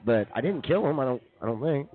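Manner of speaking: usual speaking voice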